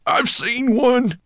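very high, loud dwarf voice; excited dwarf. A narrator's reading. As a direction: blurt it out slowly in a high squeal. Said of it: "uttered the dwarf without raising his face".

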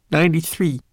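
usual speaking voice

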